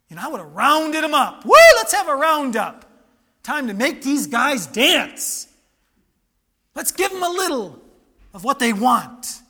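loud, relishing payback fantasy; playfully hammed-up vengeful excitement; mock-vengeful glee. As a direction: play at rounding them up, loud and rowdy. Acts out his imagined revenge.

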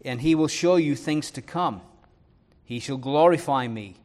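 ordinary delivery

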